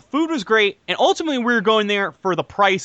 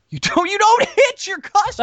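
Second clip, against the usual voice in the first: high, raised-voice protest; indignant outburst; 12 semitones higher, 4 dB louder than usual. Real, not acted. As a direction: insist on it in comic outrage. Indignantly arguing his case to co-hosts.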